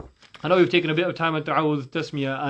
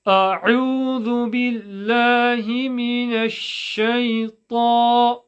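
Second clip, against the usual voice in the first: higher, sustained chanting tone; Quranic recitation; 6 semitones higher, 4 dB louder than usual. Shown as a demonstration. Recites for the students.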